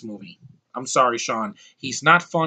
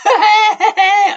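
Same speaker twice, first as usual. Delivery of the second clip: high squealing laugh; 10 dB louder than usual. Real, not acted. Laugh after Claw impression.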